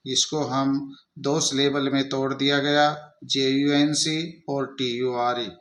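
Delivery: normal talking voice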